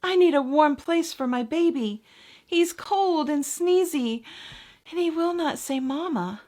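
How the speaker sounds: lightly voiced worried mouse. Mother mouse pleading.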